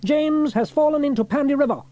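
high, urgent announcement; cried alarm. A narrator's reading. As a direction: raise the alarm to the crew. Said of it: "he cried".

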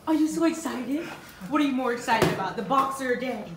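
loud, fast excited chatter; loud excited chatter to the dog. Real, not acted. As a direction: rush it out with excitement.

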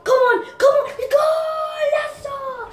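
will the match on; excited yell. Acted. Impersonating a gamer cheering on his play, yelling excitedly. Gaming commentary impression.

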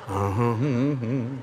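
slow, drawn-out bluesy hum; demonstrated humming. Retold or imitated. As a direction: hum along softly and very slowly. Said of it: "And hum some".

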